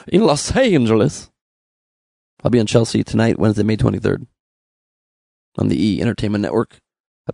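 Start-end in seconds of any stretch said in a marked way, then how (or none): 0.1-1.3 drawn-out, higher southern accent; strong southern accent. Shown as a demonstration. Comic demonstrating the word, strong southern accent. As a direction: stretch "Los Angeles" in a thick southern accent. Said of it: "in a strong southern accent".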